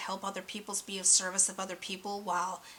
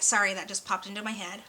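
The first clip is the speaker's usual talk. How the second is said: ordinary talk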